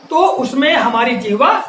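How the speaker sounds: ordinary delivery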